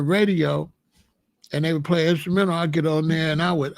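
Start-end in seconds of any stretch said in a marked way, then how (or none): none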